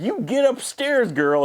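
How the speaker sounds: squeaky hammed-up order upstairs; Connie yelling. Hotel owner ordering the girl upstairs, mock scold. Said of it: "And Connie's like".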